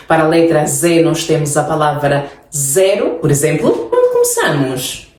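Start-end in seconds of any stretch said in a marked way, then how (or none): 3.9-5.1 teacher's question. Shown as a demonstration. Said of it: "quiz question to students".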